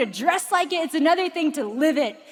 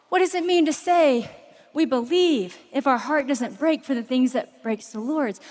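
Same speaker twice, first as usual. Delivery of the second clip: normal talking voice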